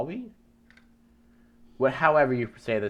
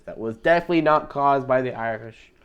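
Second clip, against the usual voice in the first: louder, higher, over-insistent denial; mock denial; 4 semitones higher, 8 dB louder than usual. Narrator covering for the Irish over Bosnia sinking, mock denial. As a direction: protest innocence a bit too firmly.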